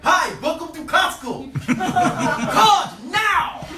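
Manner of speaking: put-on loud, high, slow African accent. African store greeter demanding cards at Costco, loud African accent. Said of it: "like, an African say that shit".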